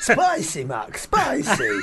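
loud, drawn-out mock complaint; Len Goodman. Judge complaining to Mark about spice, hammed-up protest. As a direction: cry out in comic outrage at spice. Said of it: "has to make Len Goodman eat".

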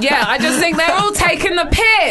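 normal talking voice

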